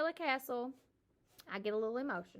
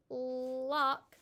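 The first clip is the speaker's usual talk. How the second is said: slow modelled word; 3 dB louder than usual. Shown as a demonstration. Teacher to class, slowly saying lock to show the l. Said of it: "the beginning sound is l".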